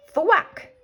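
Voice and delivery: loud, high chopping sound; the axe strikes the beanstalk; thwack. Acted. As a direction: hit it like an axe blow.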